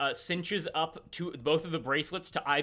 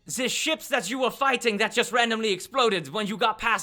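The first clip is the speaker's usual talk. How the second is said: high, loud explaining; exasperated character voice; 7 semitones higher, 5 dB louder than usual. Acted. Duke explaining again to baffled companion, loud high voice. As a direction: spell it out in a raised voice.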